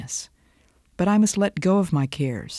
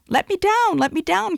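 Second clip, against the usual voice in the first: much higher, pleading little critter voice; squeaky chipmunk cry. Acted. Preacher voicing a chipmunk begging the Great Spirit, high squeaky plea. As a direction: squeal it like a tiny frantic chipmunk. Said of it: "cried the little chipmunk".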